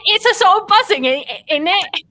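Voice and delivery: put-on laughing British accent. Reader redoing the British man, laughing. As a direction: try the posh Englishman through giggles. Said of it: "in a British accent".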